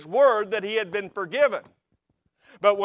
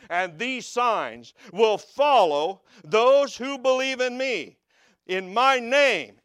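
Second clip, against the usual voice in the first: rising preacher's cadence, driving each promise home; preaching at full force; 6 semitones higher, 3 dB louder than usual. Acted. Preacher proclaiming the signs to believers, building and fervent. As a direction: proclaim it to the congregation like a revival.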